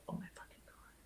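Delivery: breathless whispered disbelief; stunned hushed oh my god; shocked whisper. Retold or imitated. Shocked discovery after Googling.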